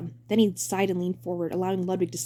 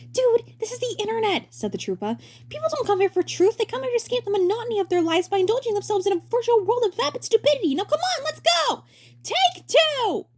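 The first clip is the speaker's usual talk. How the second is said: bright high showman voice; high cheery director voice; 4 dB louder than usual. Acted. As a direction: play the grinning director, way up high. Director ranting.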